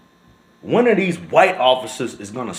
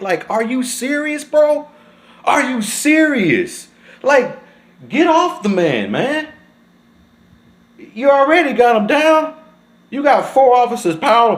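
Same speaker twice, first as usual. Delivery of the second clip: much higher and louder, exasperated; outraged disbelief; 11 semitones higher, 5 dB louder than usual. Real, not acted. Venting at the officers on the video, outraged shouting. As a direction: yell at them to get off the man.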